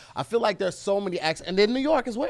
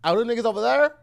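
Saint Louis accent; 8 semitones higher, 4 dB louder than usual. Shown as a demonstration. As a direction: do Saint Louis, turning "there" into "thurr". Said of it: "you give me Saint Louis".

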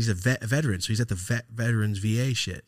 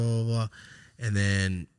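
ordinary talk, nothing marked

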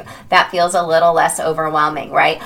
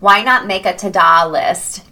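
usual speaking voice

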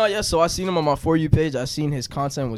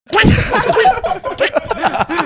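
high, loud quacking noise; imitated duck quack; 6 dB louder than usual. Retelling his after-sex joke to the group, mock quacking. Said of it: "He be quacking when he's fucking".

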